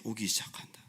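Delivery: hushed whisper of danger closing in; ominous whisper. Acted. Preacher whispering as enemies converge on Israel. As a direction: whisper it like a threat.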